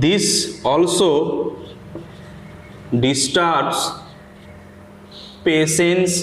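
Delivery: slow, word-by-word dictation with long pauses. Acted. Teacher dictating a paragraph to students, slow and deliberate. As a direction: read each word out clearly so students can write it down.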